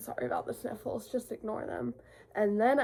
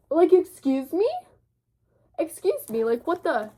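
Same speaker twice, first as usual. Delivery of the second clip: higher, louder, hammed-up indignant excuse me; mock outrage; 7 semitones higher, 6 dB louder than usual. Showing off the book edges to viewers, mock offended. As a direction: play offended diva, loud and high.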